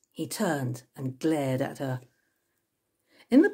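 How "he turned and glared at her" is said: ordinary delivery, nothing marked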